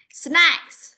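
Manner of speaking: "Snacks" with a clearly sounded S at both ends; drawn-out "Snacks", every S hissed out clearly; clear S sounds. Shown as a demonstration. Teacher showing a pupil the missing S, clear S sounds. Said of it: "Cái S này đâu".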